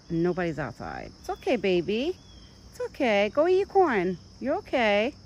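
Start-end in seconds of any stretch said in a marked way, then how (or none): 1.2-5.1 soothing pet talk. Real, not acted. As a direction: coax the frightened deer back to his food. Calming scared deer to eat.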